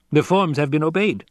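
high tenor courtier; light, prim henchman voice; Piter. Acted.

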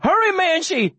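high, urgent scream of the name. A narrator's reading. Boy screaming to his fighting dog, high and urgent. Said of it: "I scream".

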